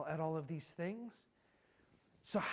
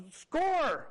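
sudden high, loud sideline shout; exasperated fan's yell, pitched way up; bursting-out yell; 10 semitones higher, 6 dB louder than usual. Retold or imitated. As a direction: burst out like a frustrated soccer parent. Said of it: "somebody just burst out".

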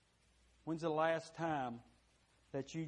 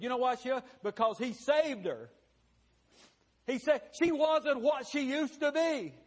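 rising, louder preaching burst; preaching peak; 9 semitones higher, 7 dB louder than usual. Real, not acted. Pastor rising to full force before his congregation. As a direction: build to a loud climax.

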